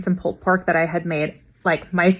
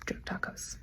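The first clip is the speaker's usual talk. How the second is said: breathy under-the-breath whisper; whispering drug tacos to herself; whispered aside; 19 dB quieter, slower than usual. Real, not acted. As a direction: drop to a whisper as a deadpan aside. Muttered aside after punchline.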